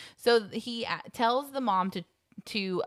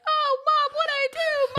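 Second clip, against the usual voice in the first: put-on very high, loud kid's yelp; mock wailing; 7 dB louder than usual. Schwartz being beaten by his mom, mock wail. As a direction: wail like a punished child, hammed-up.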